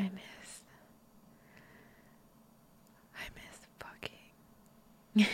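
slow breathy whisper into the ear. Acted. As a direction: lean in and breathe the secret slowly. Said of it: "let me whisper it in your ear".

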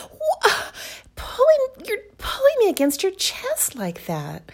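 high, loud flustered gasping; flustered protest. Acted. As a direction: splutter in shock. Protests being pulled close.

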